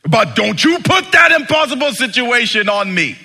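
high, loud protest; indignant shout. Pretend. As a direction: fling it back, outraged. Church refusing impossible situation.

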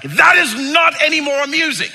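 high, loud shouted protest; hammed-up loud yell; mock yelling. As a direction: shout it like a yelled prank reply. Acted yelling in retold prank.

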